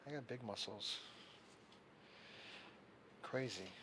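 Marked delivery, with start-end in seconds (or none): none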